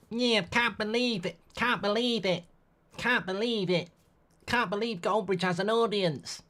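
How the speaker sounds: high, affected mock voice, pushed well up; a self-mocking high impression voice; cringy put-on impression. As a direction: put on a cringey fan voice and hold it. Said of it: "it's an impression, and it's, it's a cringy one".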